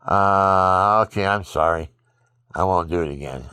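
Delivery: loud, mocking, insincere sorry; mock a sinner who apologizes without meaning it; lightly voiced careless sinner brushing off repentance; half-hearted apology. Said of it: "not somebody who says".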